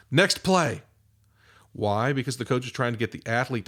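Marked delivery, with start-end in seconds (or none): 0.1-0.8 coach's call. Retold or imitated. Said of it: "you hear this all the time".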